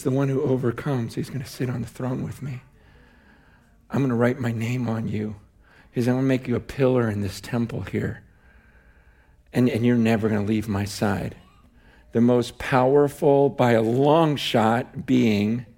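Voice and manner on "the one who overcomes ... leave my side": quiet quoted scripture. Retold or imitated.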